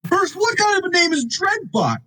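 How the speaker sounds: high incredulous question. Real, not acted. Fan mocking a villain's name, high incredulous question.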